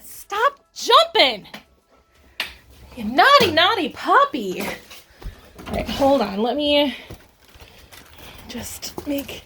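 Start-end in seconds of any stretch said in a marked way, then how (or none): 0.0-4.6 high, slow playful scold; telling off the jumping puppy playfully; mock scolding puppy. Real, not acted.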